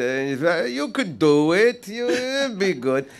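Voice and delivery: high, slow director impression; Gary. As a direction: stretch it out in a high put-on voice. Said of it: "and Gary said".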